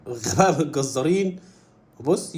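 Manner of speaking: usual speaking voice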